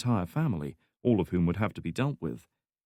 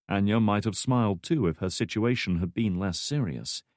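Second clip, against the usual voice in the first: ordinary delivery, nothing marked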